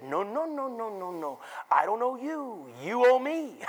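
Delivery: sing-song child mimicry; playful impression of a small boy chanting; imitating his little son. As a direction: slide down the noes, then lift the retort. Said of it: "He said".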